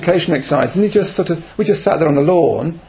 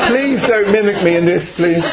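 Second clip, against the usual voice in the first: laughing; 7 semitones higher than usual. Real, not acted. Laughing plea to team.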